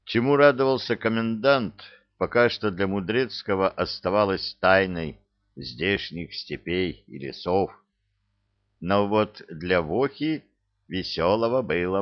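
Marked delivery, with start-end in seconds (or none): none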